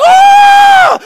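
very high, loud crowd scream; mock panicked scream. Crowd jumping up after thrown goldfish, mock panicked scream. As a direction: imitate a panicking crowd's shriek. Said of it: "everyone gets up and is like".

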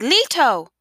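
high, loud call with a falling tail; anguished cry. A narrator's reading. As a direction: cry out after someone leaping away. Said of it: "Isabel cried".

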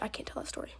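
quick hushed whisper. Real, not acted. Podcaster hesitating before telling gossip, quick whisper. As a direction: catch yourself and whisper it fast.

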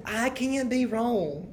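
usual speaking voice

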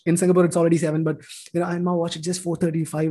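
ordinary talk

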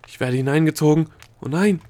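loud, high-pitched panic; frightened shout to a companion; alarmed cry. A narrator's reading. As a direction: cry out as you start to sink. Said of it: "rief sie zu Opal".

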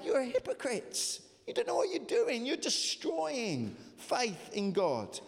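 high impassioned preaching voice; preacher voicing Jesus, ardent; fervent preaching. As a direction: thunder Jesus' rebuke at them.

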